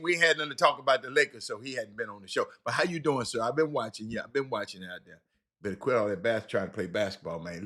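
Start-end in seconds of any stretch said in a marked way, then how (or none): none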